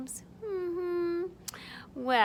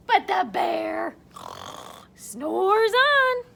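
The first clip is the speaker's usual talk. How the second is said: emphatic refrain; 4 semitones higher, 6 dB louder, slower than usual. A narrator's reading. Climactic refrain.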